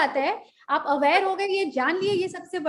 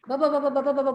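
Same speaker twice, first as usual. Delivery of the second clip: imitated nonstop babbling; slower than usual. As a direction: run the syllables together like unstoppable chatter. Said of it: "बोलते ही जा रहे हैं".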